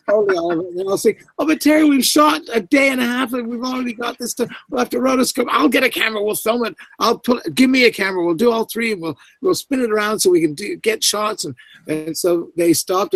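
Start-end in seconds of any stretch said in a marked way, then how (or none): 1.4-4.5 worried protest. Retold or imitated. Team protesting to the director. 4.7-11.4 excited planning. Retold or imitated. Director overriding team with rapid plans.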